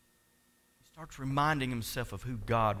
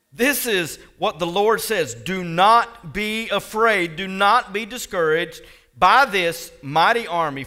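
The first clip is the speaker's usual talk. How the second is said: loud, raised, weighty delivery; forceful preaching of God's word; prophetic proclamation; 5 semitones higher, 9 dB louder than usual. Acted. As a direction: declare it to a whole army. Proclaiming the Lord's message.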